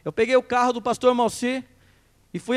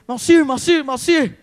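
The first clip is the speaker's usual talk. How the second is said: calling out; 5 semitones higher, 5 dB louder, slower than usual. Retold or imitated. Calling out at his door.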